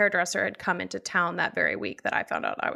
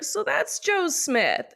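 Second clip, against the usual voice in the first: dramatic reveal; 8 semitones higher, slower than usual. Retold or imitated. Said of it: "I was like".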